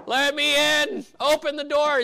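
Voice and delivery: high, loud, slow drawn-out call; shouting to be let in. Retold or imitated. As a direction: bellow through the locked gate. Peter shouting outside the gate.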